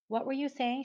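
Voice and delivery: blank, vacant ask; distant voice. A narrator's reading. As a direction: drift off like someone in a trance. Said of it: "she asked in a distant voice".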